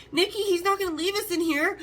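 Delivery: high-pitched, mildly panicked plea; retold panic. Stuck woman pleads with her boyfriend in the elevator, retold panic. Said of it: "started to have another panic attack".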